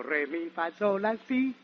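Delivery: normal talking voice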